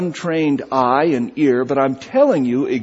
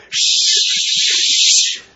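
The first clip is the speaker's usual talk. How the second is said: sharp hissed shush, higher pitched; venomous shush. Retold or imitated. Woman in front shushing chatting brothers, venomous shush. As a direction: shush them like an annoyed stranger. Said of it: "with venom in her voice said".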